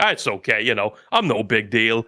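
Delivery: low, modest brush-off; humble shrug. Acted. Hakuro answers the apology, humbly low. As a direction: play it down in a deep, laid-back voice. Retelling a modest dog's reply.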